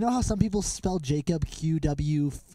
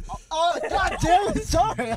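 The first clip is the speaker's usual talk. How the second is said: laughing; 13 semitones higher than usual. Real, not acted. Breaking up laughing.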